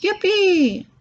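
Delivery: high, whooping cry of joy; joyful yell. A narrator's reading. Old grandpa exploding with joy, high whooping yell. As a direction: let it burst out of him. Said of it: "and yelled".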